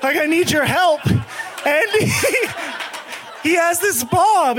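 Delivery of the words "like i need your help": pleading. Retold or imitated. Asking the bodega guy for help, lightly desperate plea. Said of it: "and I'm like".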